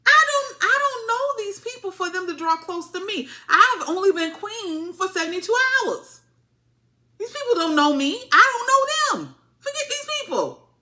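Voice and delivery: high, loud, put-on voice for Helena refusing; mocking high voice of a queen who doesn't want to go. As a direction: pitch it way up and push back like an upset young queen. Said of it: "And she was like".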